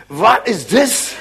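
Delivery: put-on slow question in a German accent. Germans at Pentecost asking their typical question, German accent. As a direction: ask it like a puzzled German, drawn out. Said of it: "asked the typical German question".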